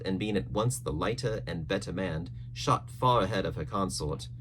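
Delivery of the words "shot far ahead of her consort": plain reading voice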